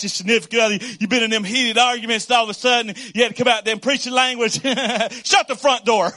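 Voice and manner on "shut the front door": louder, hammed-up exclamation; mock outburst. Pastor mimics a heated spouse argument, a playful loud outburst.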